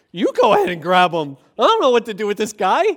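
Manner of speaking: loud mock exasperation; exasperated retort. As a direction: throw the job back at them, loudly. Acts officers answering back.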